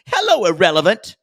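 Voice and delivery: loud quoted put-down greeting; catchphrase. Retold or imitated. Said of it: "and she's like".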